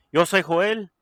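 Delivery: lucha libre announcer. Acted. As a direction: call out your name to the crowd. Podcast intro announcement.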